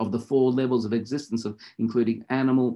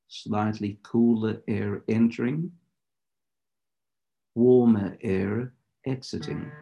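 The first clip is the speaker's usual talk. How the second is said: guided meditation; 2 semitones lower than usual. Acted. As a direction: lead them into stillness, unhurried.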